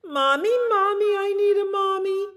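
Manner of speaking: put-on high, loud baby-bird wail; wailing cry. A narrator's reading. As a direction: bawl for mother, hammed up for children. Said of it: "he started to cry".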